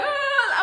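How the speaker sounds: a short, high imitated wail; mock wail. Retelling the distraught wife, a short high mock wail. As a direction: squeal it out like the sobbing wife. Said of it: "crying to wailing".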